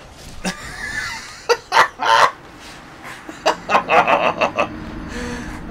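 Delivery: long, loud, wheezy burst of laughter; hard laughing fit. Real, not acted. Player laughing at own disaster, long hard laugh. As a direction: crack up helplessly. Laughs after being hit badly.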